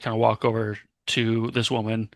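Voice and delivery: ordinary delivery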